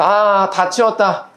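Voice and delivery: put-on slow, drawn-out child's declaration; child finished tidying. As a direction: lightly voice a child done with a chore. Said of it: "아이가 다시 한번 치웠어요". (일을 끝낸 아이를 가볍게 흉내 내며 길게)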